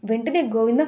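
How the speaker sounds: usual speaking voice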